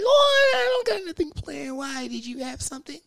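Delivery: put-on very high squealing girly voice; eager girl. Girl squeals at the guy that she's free, very high. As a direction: squeal it out, overeager.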